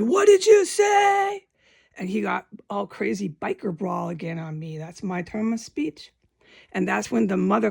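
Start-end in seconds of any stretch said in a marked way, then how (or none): 0.0-1.5 high, loud furious demand; retold shout of the stepfather; angry yell. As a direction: shout it at him in a rage. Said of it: "He said".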